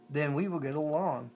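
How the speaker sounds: ordinary delivery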